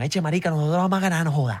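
put-on quick Colombian coastal accent; mock costeño accent, quick. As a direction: imitate a fast-talking costeño. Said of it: "Y salen unos costeños".

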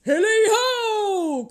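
very high, loud, drawn-out holler; booming singsong call; hearty cry. A narrator's reading. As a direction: let out a long jovial whoop. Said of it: "cried old Fezziwig".

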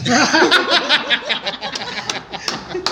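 long burst of laughing; real belly laugh; laughter. Real, not acted. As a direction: laugh hard at the punchline. Laughter after the kinder punchline.

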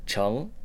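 "cheng" slow, with a rising second tone; Mandarin second tone. Shown as a demonstration. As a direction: let "cheng" rise. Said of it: "is in the second tone".

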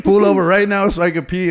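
high, loud mock demand; mock demanding yell. Drunk teen to the cops, high loud mock demand.

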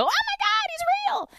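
high-pitched mock shriek; imitated scream. Host imitating guests startled by the wax figure, mock scream. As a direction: squeal like a freaked-out guest, far above your own pitch. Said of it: "they freak out and scream".

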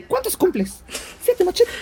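high, put-on clown voice; singsong kids' TV clown; Cepillín voice. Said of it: "o imitándolo como".

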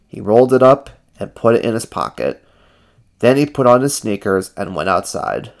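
usual speaking voice

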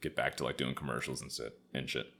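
normal talking voice

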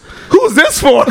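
laughing mock shout. Girlfriend finds the bag and shouts through laughter. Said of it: "She pulls that and goes".

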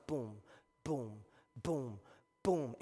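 low, evenly spaced booms; imitated heartbeat. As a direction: thump it out like a pounding heart. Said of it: "your heart will start going".

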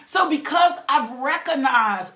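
normal talking voice

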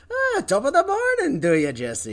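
high, loud mock Irish greeting; Irish accent. Co-host answers with top of the morning, hammed-up Irish accent. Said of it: "how to do an Irish accent".

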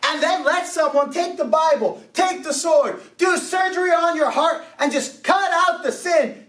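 fired-up preacher shouting the call; preaching at full force. Acted. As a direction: drive the call to repent home. Preaching climax.

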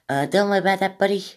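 high-pitched, put-on Australian; attempted Australian accent. Podcaster imitating a kind streamer reassuring a donor, attempted Australian. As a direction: have a go at a hammy Australian accent. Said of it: "should I try an Australian accent?".

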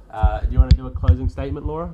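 loud fast call to another room; yelling across the room. Real, not acted. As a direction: shout over to someone out of sight. Calling out to Laura elsewhere.

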